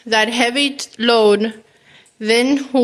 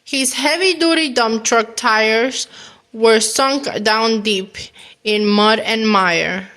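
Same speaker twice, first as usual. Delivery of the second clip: ordinary delivery, nothing marked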